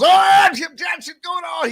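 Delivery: high, loud excited shout of a race caller; excited announcer impression. Co-host imitating Dale's excited booth call. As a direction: mimic Dale calling a wreck, thrilled and loud. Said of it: "He just gets so excited".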